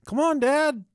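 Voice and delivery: put-on high, little girl call; high child voice calling dad; daughter calling. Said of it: "she said".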